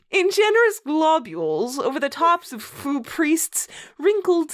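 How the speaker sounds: mock-grand read-aloud, stifling laughter; story narration. Host reading a bad fanfic to co-host, mock-solemn narration. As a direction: read the purple prose with a straight face.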